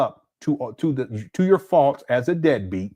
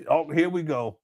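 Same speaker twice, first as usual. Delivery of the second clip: ordinary delivery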